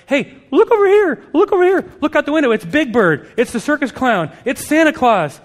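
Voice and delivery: high, loud mock excitement; frantic distraction. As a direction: distract a wailing child with fake wonder. Parent distracting a tantruming child.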